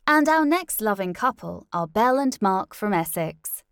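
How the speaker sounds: loud, bright radio announcer; show announcer. Acted. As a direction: introduce the couple on air. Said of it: "Jessica announced".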